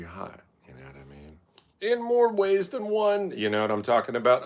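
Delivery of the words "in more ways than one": high, slow sly delivery; sly joke voice. Real, not acted. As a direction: land the pun slyly.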